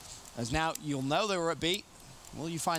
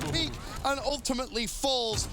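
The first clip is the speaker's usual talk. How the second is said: high, excited esports call; hyped play-by-play; 11 semitones higher than usual. Acted. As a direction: call the action at full energy.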